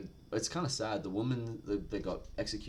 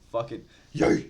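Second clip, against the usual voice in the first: louder, punchy hype shout; hyped yell; 2 semitones higher, 9 dB louder than usual. Retold or imitated. Guts answering the guard, a hype yell. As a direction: throw it at him like a dare.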